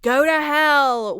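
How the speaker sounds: loud, slow yelled curse. Retold or imitated. Alice stubbing her toe and yelling a curse. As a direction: spit it out in pain at full volume. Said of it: "and Alice yells".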